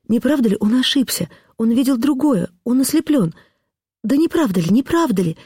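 high, frantic pleading; begging to be told it is false; desperate pleading wife. A narrator's reading.

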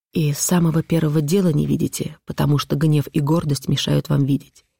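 plain reading voice

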